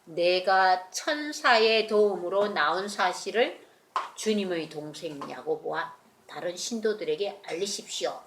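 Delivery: very slow, measured and grave; slow solemn speech. Acted. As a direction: deliver Peter's message slowly, with weight. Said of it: "베드로가 뭐라고 그랬어요". (베드로의 말을 아주 천천히 엄숙하게)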